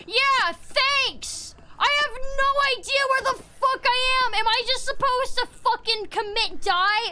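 high-pitched exasperated tirade; fed-up, high outburst; frustrated rant. Real, not acted. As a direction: rant indignantly, voice pushed up high. Ranting about being lost.

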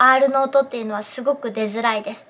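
normal talking voice

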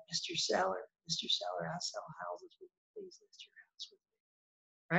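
very soft, timid little plea to the seller; mousy and shy. Retold or imitated. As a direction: shrink your voice and barely dare to ask. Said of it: "you get a little mousy, you get a little shy".

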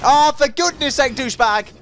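high, loud exasperation; exasperated outburst. Real, not acted. Gamer at the player who beat him, exasperated. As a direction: burst out at a rival, exasperated.

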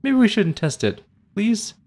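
high anxious pleading voice; frightened pleading. Acted. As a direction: plead nervously, voice climbing.

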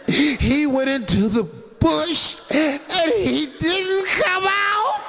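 high, slow, rising whine; high pleading woman's voice; whiny woman. Retold or imitated.

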